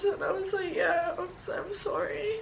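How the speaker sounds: quietly breaking into tears; telling how she apologised to her mum, tearful; crying. Real, not acted. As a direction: let the sorry crack and fade.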